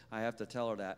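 low, soft, sheepish aside; a little embarrassed. Retold or imitated. Buyer having to tell her the price, a little embarrassed. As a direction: shrink into a reluctant low murmur. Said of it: "I was a little embarrassed".

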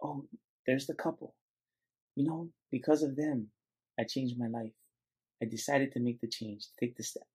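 imagined whispering. Pretend. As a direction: quote the room's murmurs softly, half-whispered at most. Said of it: "people in the room start whispering".

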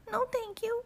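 very high small voice; small child voice. Acted. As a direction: answer like a sulky toddler. Pookie answers.

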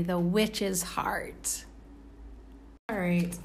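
slow whispered witch voice. Acted. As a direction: breathe it out like a witch, slowly. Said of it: "Talking like a witch".

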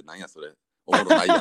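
mock Kansai jab; punchy Kansai comeback; Kansai dialect. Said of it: "いきなりの関西".

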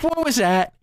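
jumps up high in startled surprise; a sudden, high-pitched yelp of confusion; startled blind man. Retold or imitated. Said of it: "He's like".

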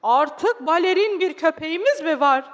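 loud, incredulous asking; astonished question. Acted. Father asking if they now have a ballerina dog, astonished. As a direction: ask it in amazed disbelief.

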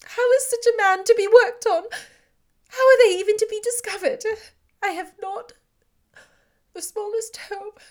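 high, loud, anguished; despairing. Acted. Elizabeth answering Darcy, high and anguished despair. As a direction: pour out hopeless anguish. Heroine in despair over sister.

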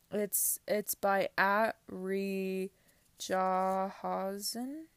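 slowly sounding out the name. Real, not acted. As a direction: drag the username out syllable by syllable. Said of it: "I don't know how to pronounce this username".

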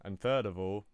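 ordinary talk

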